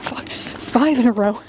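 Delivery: usual speaking voice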